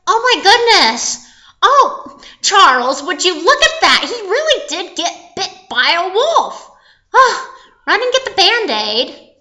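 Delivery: high, loud fussing astonishment; hammed-up horrified mom; shocked mother. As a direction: exclaim loudly in motherly alarm. Shocked mom calls to Charles.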